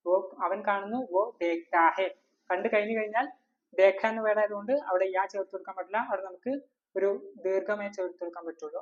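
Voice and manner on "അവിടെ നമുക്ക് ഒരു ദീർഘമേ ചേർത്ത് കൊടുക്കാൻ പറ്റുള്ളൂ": usual speaking voice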